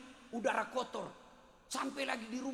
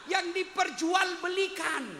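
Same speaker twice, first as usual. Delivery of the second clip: much higher and louder, slower; passionate outcry; 9 semitones higher, 9 dB louder, slower than usual. Shown as a demonstration. Preacher cries out the wife's heart-song to the congregation, loud and high. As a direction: belt it out as a heartfelt cry. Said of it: "dengar itu curhatan hati istrimu".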